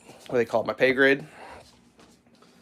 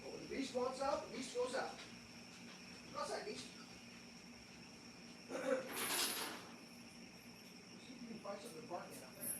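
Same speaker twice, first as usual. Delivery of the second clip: distant voice, faint and far; from another room; 9 semitones higher, 19 dB quieter, faster than usual. Real, not acted. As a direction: call from the next room. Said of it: "I'll try to keep talking from the other room".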